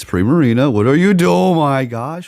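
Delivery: high, loud amazed outburst; excited disbelief. Real, not acted. Player cheering his Pokemon's surprise win, high and loud. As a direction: gasp it out, thrilled.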